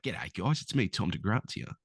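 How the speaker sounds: lightly voiced Australian bloke; Australian accent. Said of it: "The Australian variant?".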